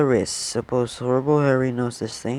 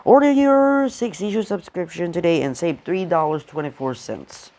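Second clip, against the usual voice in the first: ad read; 4 semitones higher than usual. Acted. Reads comic ad aloud.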